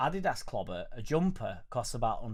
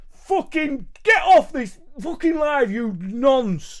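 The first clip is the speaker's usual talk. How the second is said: much higher, much louder slow hammed-up bellow; mock furious yell; 13 semitones higher, 14 dB louder, much slower than usual. Streamer ending the live with a mock pub-guy yell. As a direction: roar like a raging pub bloke.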